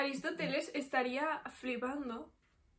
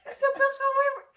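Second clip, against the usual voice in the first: laughing; 11 semitones higher, 7 dB louder, much slower than usual. Real, not acted. Laughing on the name.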